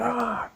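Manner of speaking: high, drawn-out comic cry; cartoon yell of someone getting thrown; mock yell. That guy getting suplexed, high mock yell.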